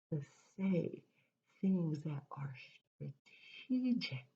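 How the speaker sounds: slow, with pauses between phrases; careful, deliberate speech. Shown as a demonstration. Speaker explaining careful speech to listeners, slowly and deliberately. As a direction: weigh each phrase before saying it.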